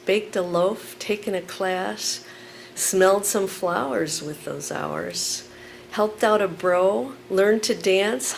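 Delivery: loud, emphatic spoken word; poem performance. Acted. Performs poem Killing Time.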